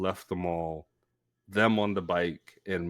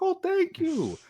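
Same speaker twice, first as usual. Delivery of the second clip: much higher, touched thank you; delighted high voice. Retold or imitated. Thanking his boss on the phone, much higher and touched. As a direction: gush like a flattered employee. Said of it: "I'm like".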